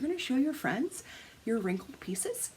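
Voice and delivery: high, softly disapproving mom imitation; passive-aggressive. Mom disapproving of wrinkled fabric, passive-aggressive. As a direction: imitate a mother's pointed, judging question. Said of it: "she says it just like that".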